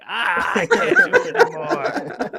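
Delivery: high slow pained groaning whine. Retold or imitated. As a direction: moan like it's unbearable. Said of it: "I'm, like, over here".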